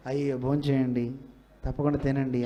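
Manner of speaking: low, gentle coaxing invitation; gracious host. Shown as a demonstration. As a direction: coax the guest kindly to eat. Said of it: "దీన్నే గట్టిగా అంటే ఎలా ఉంటుంది".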